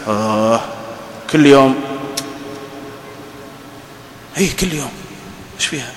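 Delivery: soft, reluctant muttered reply; grudgingly answer, under your breath; grumbling husband. Acted.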